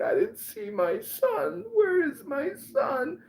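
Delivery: high, slow, wailing falsetto; mock crying mother. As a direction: imitate a distraught mother wailing. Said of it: "there's a woman crying in the stands".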